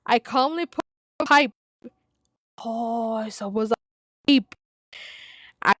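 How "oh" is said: ordinary talk, nothing marked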